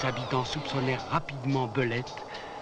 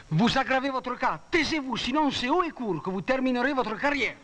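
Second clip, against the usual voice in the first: higher stern scolding voice; scolding judge; 8 semitones higher than usual. Acted. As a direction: warn the accused sharply.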